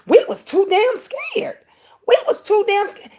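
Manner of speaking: high-pitched quote of a grown son; mock high-pitched protest; retold emphatic protest. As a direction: push it up high, imitating his insistence. Voicing son's emphatic protest, retold.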